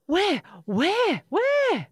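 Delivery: high, loud frightened yelps; panicked. A narrator's reading. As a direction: fire off startled questions, rising in panic. Monster panics at hearing of monster.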